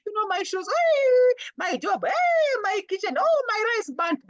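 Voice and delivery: high, wailing mock lament, stretched out; hammed-up crying complaint in a high voice; mock whining complaint. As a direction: whine like someone crying over small things. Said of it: "people complain a lot".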